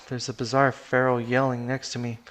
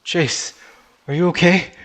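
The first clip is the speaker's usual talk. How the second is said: raised, louder urgent check; urgent concern; 5 semitones higher, 8 dB louder than usual. Acted. Friend checks on dazed Chase after the crash, urgent concern. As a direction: call out with alarm, rising at the end.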